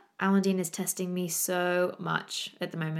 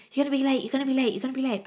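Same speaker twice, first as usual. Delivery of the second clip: nagging husband; 5 semitones higher, much faster than usual. Retold or imitated. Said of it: "all Hendrik could do was go".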